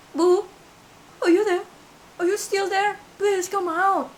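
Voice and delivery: high, loud, sobbing call. Retold or imitated. Scared girl calling the vanished woman to come out, sobbing. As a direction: call out through sobs. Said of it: "yang sesenggukan".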